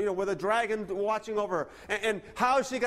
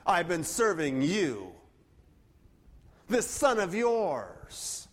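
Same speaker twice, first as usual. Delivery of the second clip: very slow, each word dragged out with scorn; a son refusing to say brother; contemptuous emphasis; 3 semitones lower, much slower than usual. Retold or imitated. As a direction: spit out This son of yours slowly. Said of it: "He can't even say, "My brother".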